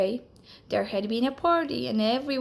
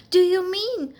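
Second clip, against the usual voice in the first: eager question; 9 semitones higher, 7 dB louder than usual. Acted. Alexander asks excitedly.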